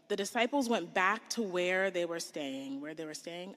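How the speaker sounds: usual speaking voice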